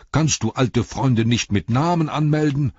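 loud, high-pitched telling-off; flustered innkeeper's reproach, easing slightly toward the end; indignant calling. A narrator's reading. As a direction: scold your servant in exasperation. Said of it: "rief er".

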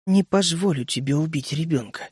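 calm but dangerous; угрожающе спокойно; menacingly calm. A narrator's reading. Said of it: "Её голос был угрожающе спокойным".

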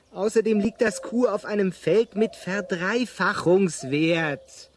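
ordinary delivery

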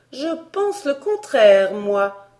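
high, loud, slow retort; emphatic contradiction. Acted. The governess contradicting Elsie, emphatic. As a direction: insist loudly, drawing it out.

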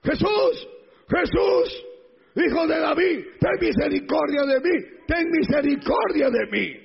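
high, pleading shout; crying out. Acted. Blind Bartimeo begging Jesús for mercy, crying out. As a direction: call out for mercy at the top of your range. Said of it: "comenzó a gritar".

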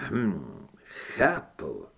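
low, subdued reply; cool acknowledgement. A narrator's reading. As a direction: take it in with restraint. Father coolly takes it in. (tiché, chladné přitakání)

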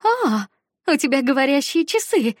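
high loud shout over music. Acted. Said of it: "крикнула Аника мне в ухо".

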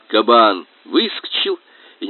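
usual speaking voice

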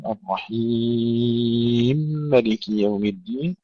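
slow الرحيم with very long i into ملك; merged mim, full madd. Shown as a demonstration. Teacher showing third option, longest madd in الرحيم ملك. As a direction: stretch الرحيم fully, fuse its mim with ملك. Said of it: "القصر والتوسط والمد".

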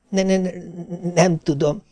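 high, soft, teeth-chattering stutter; shivering stammer. A narrator's reading. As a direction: stutter feebly as if shaking with cold. Shivering wife stammers her answer. (magas, vacogó, dadogó hang)

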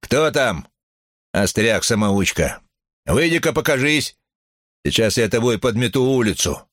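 high-pitched, challenging acted call; thundering threat. A narrator's reading. Gabe threatens a heckler in the crowd, thundering challenge. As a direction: dare the heckler to step forward. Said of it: "прогремел Гейб".

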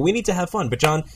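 normal talking voice

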